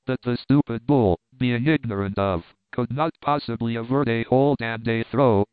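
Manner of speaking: plain reading voice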